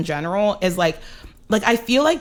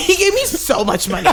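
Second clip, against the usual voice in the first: high, laughing through the words; really cracking up while talking; 12 semitones higher than usual. Real, not acted. Comic telling a friend about Dad's generosity, laughing.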